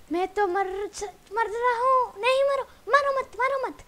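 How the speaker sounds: high, loud, frantic begging; panicked yelling. Real, not acted. Player dying in the game, panicked pleading. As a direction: cry out as the attack closes in.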